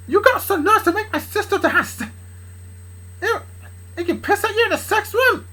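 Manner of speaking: high, strained, stumbling outrage; angry rant. Acted. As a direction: rage at your daughter's lover in a high voice. Dad rages at Charlie.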